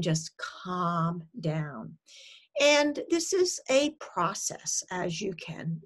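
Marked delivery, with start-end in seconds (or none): 0.0-2.0 slow, low soothing; soft, unhurried acted reassurance; calming healthy voice. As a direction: stretch it out to settle the worry. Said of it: "that's the job of the healthy voice".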